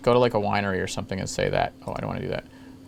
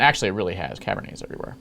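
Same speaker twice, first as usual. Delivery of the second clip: usual speaking voice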